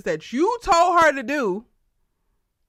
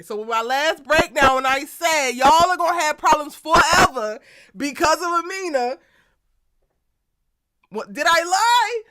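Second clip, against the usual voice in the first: louder, higher gloating; triumphant I told you so; 4 semitones higher, 8 dB louder than usual. Real, not acted. Telling viewers her prediction came true, loud gloating. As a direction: rub it in that you were right.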